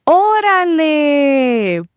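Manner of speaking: ordinary talk, nothing marked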